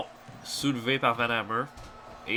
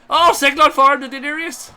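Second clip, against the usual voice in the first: louder excited call; 11 semitones higher, 9 dB louder than usual. Real, not acted. Repeated call, louder and higher.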